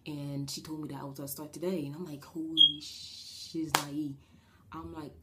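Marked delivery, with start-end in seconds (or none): 2.2-4.1 slow, drawn-out exclamation of shock; slow stunned outburst, put-on voice; mock shock. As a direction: stretch it out in comic alarm. Nervous exclamation on first day.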